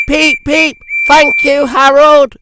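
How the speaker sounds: high, loud whistling engine. Acted. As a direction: toot it out gratefully. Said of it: "he whistled".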